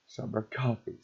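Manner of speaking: usual speaking voice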